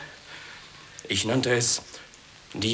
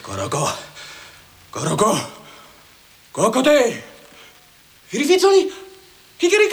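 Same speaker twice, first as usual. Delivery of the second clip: from plain to very high loud cry; building declamation; 13 semitones higher, 8 dB louder, much faster than usual. Acted. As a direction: climb to a crowing shout. Poet builds to crowing cry.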